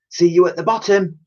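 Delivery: calling up. Acted. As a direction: call it up from below. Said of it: "she called up".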